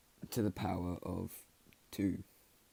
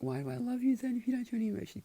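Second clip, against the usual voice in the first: high, rushed acted outburst; upset blurting; faster than usual. Girl blurting her love mid-quarrel, high frantic retelling. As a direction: rattle it out high-pitched.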